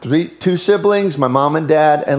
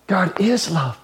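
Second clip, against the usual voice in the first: soft repeat; 6 dB quieter than usual. Shown as a demonstration. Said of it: "preacher repeats God is love".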